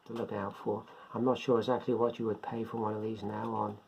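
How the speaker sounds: ordinary talk, nothing marked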